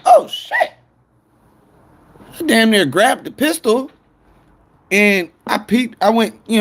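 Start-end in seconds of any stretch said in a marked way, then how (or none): 0.1-0.7 sudden high squeak of alarm; a retold jolt of fright, pitched way up; startled oh shit. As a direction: jump at the knock and blurt it out high. Startled by police knock at door.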